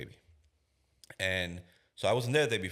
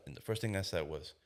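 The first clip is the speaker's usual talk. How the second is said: ordinary delivery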